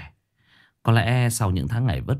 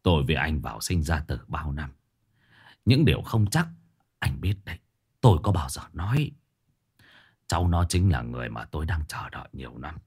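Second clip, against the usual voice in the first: put-on stern old master; 6 semitones lower, 5 dB quieter than usual. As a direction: command the workers calmly from low in the chest. Master confides to old friend. (giọng thầy pháp trầm, chậm rãi)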